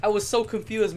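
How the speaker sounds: normal talking voice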